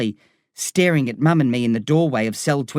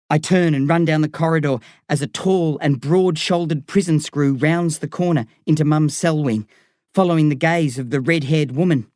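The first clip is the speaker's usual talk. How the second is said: ordinary delivery, nothing marked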